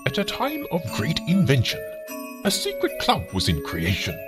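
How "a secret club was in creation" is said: usual speaking voice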